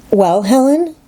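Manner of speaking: put-on girl urging her friend to continue; young Jane, urging. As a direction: press her to go on. Jane prompts Helen.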